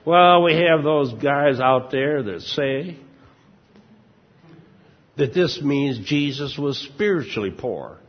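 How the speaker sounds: mock southern preacher accent. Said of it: "these guys always have a southern accent".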